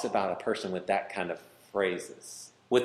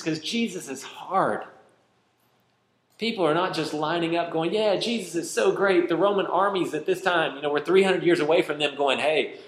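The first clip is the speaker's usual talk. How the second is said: high, driving sermon voice, pressing the point; impassioned preaching; 6 semitones higher, 3 dB louder than usual. Acted. Preacher warning his congregation, impassioned high preaching. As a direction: preach it with rising urgency.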